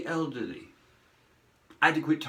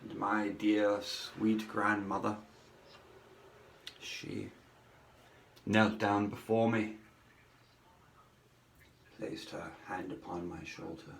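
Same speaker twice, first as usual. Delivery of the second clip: tender hush; 5 semitones lower, 6 dB quieter than usual. Acted. Tender memory of grandmother.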